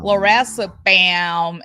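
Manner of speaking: slow, loud drawl on Lorazepam; imitated Southern character. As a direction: echo Lorazepam with a long last vowel.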